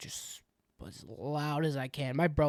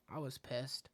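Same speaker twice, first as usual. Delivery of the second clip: sighing whisper; 9 dB quieter than usual. Real, not acted. Sighs, whispered.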